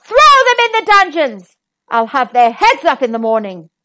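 king bellowing orders to his guards; bellowed. Acted.

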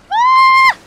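sharp high scream; startled scream. Real, not acted. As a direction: scream in sudden shock. Startled shout in game.

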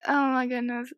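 usual speaking voice

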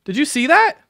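high-pitched, loud surprised outburst; genuinely amazed, pitch way up; real astonishment. Real, not acted. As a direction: react in amazed disbelief, voice shooting up. Spots that someone was credited.

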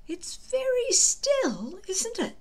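put-on high, slow, doubtful boyish voice; a nervous friend peering at a silent basket; uncertain Fishlegs. Fishlegs doubting the sleeping dragon, high uncertain voice. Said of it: "less certain of the fate theory".

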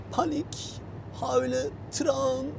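high-pitched pretend whimper; mock crying. Driver feigning panic over the route, mock tearful. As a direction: play at panicked tears, voice pushed high.

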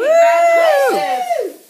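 long, high, loud, drawn-out congratulations; ecstatic shout. Real, not acted. Congratulating winner Tiffany Gray, high drawn-out shout. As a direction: stretch it out like a cheer.

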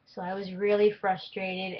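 normal talking voice

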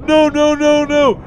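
high, alarmed cries; panicked pleading. Real, not acted. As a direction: beg the monster to back off. Monster chasing the player.

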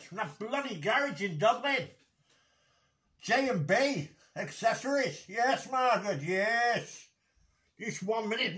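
usual speaking voice

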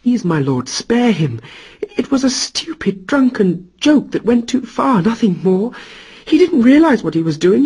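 high, urgent begging; pleading Sigyn. Acted. Sigyn begs Thor to spare her husband. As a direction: beg the god to spare him. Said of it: "Sigyn pleaded with him to spare her husband".

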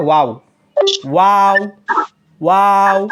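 ওয়াও with both lips rounded, light and thin. Shown as a demonstration. Teacher showing the rounded-lip ওয়াও. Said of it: "দুই ঠোঁট গুল করে".

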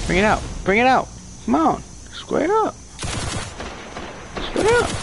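high, squeaky taunt climbing upward; mock the opponent with a shrill dare; rising taunt. Real, not acted. Gamer daring an opponent, high mocking squeal.

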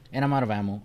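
ordinary delivery

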